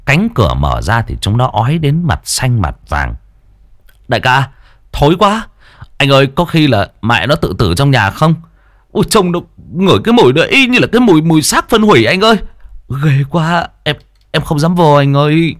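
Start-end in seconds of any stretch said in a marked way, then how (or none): 4.1-15.6 high, quick, frightened whining to the boss; a gagging underling begging not to go in; scared henchman. Acted. Henchman to boss Tuấn, frightened and high.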